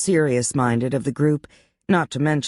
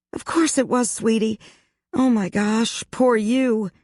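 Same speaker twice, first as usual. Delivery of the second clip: high sympathetic friend voice; 8 semitones higher than usual. A narrator's reading. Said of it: "Ashley looked immediately contrite".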